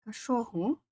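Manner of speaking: cachorro said the Brazilian Portuguese way, ka-SHO-hu; Portuguese pronunciation. Shown as a demonstration. Teaching a chat viewer the Portuguese word cachorro. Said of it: "dog in Portuguese, it can have two words".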